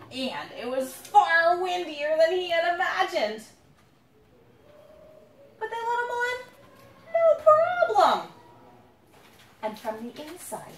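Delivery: storybook narration for little ones; reading to children. A narrator's reading. Parent reading a picture book to the children, storybook voice. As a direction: read it to the kids gently.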